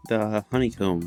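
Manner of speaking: usual speaking voice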